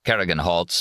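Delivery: ordinary delivery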